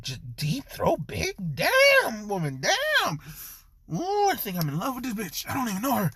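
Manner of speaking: high, breathless raving; swooning in overwhelmed wonder; high gushing disbelief; ecstatic awe. Acted. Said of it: "Naruto's just like".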